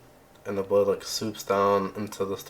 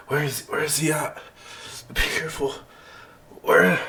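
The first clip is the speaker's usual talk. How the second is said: higher-pitched, alarmed searching; anxious; 6 semitones higher than usual. Acted. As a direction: glance about in alarm as you speak. GM voices frightened injured Winter.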